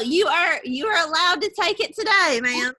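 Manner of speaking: ordinary talk, nothing marked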